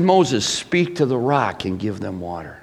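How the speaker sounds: soft, low, calm words of God; gentle instruction. Retold or imitated. God gently telling Moses what to do, quiet and calm. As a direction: say it softly, kindly. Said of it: "He said".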